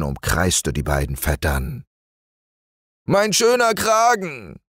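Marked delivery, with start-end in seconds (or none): 3.1-4.6 high, wailing lament. Acted. Bear wails over his stung collar, high lament. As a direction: wail over the ruined collar. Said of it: "jammerte Hieronymus Kragenpeter".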